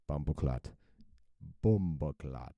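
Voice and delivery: bumbleclot in a thick Jamaican accent. Shown as a demonstration. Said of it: "in the most Jamaican accent".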